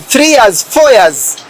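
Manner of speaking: normal talking voice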